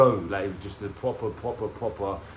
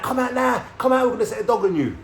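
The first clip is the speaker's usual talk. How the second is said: high, loud shouted warning; imitated police shout; 13 semitones higher, 5 dB louder than usual. Police to man hiding in cupboard, mock shouted threat. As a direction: yell an order as an officer would.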